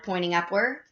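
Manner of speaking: usual speaking voice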